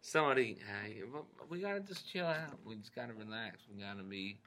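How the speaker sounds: very soft, low, laid-back movie star; Matthew McConaughey. Acted. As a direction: mellow actor impression, telling people to relax. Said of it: "So that is your Matthew McConaughey".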